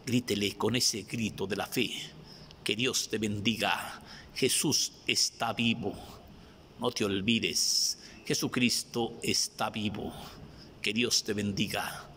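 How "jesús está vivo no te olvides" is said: ordinary talk, nothing marked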